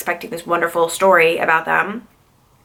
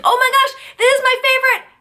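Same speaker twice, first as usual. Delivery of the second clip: excited squeal; 3 dB louder than usual. Retold or imitated. Retelling delight at favorite song.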